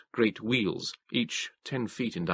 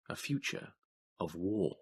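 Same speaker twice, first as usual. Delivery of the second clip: hushed and ominous, softer; hushed dread; 2 semitones lower, 10 dB quieter than usual. A narrator's reading. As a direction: whisper "a future of war" very softly. Dramatic hush.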